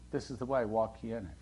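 soft, low, gentle inner voice; quiet voice of God. Retold or imitated. The Lord's voice behind the believer, hushed and low. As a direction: murmur it like a still small voice.

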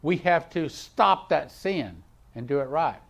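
ordinary talk

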